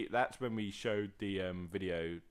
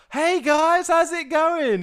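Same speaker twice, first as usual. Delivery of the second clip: very high and loud mock greeting; squealed loud friendly hello; over-the-top cheery stranger; 17 dB louder, much slower than usual. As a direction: ham up a hyped internet guy saying hi.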